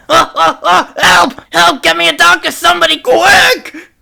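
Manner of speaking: high, frantic call for help; panicked cries. Acted. Sailor tied up, calling out for help. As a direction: yelp for a doctor in mock panic.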